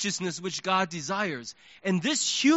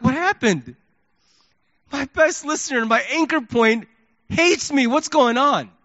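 shocked inner outcry; 5 semitones higher, 4 dB louder than usual. Retold or imitated. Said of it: "I'm like".

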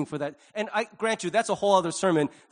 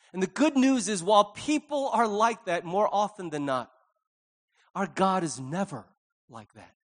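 ordinary delivery

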